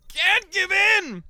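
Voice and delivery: high, loud, very drawn-out cry; mock desperate shout. Exhausted streamer fighting own body, hammed-up desperate shout. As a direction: strain it out loud as a hammed-up battle against sleep. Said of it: "and I'm like".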